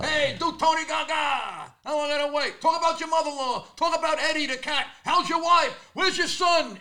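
mock heckler shouts from the audience; heckling fans. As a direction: call out like rowdy fans in the crowd. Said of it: "People are yelling out bits of mine".

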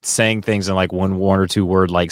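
ordinary delivery

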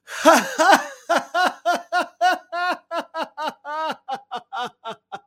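loud, high, sustained belly laugh; forced hearty laughter. Shown as a demonstration. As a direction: laugh on purpose, big and long. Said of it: "I'm just doing this".

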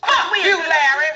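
ordinary delivery

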